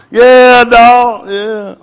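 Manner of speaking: loud, high whoop of approval; excited approval. Real, not acted. Speaker applauds Buck for speaking up, hollering approval. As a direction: cheer him on, drawn out. Cheers Buck after his answer.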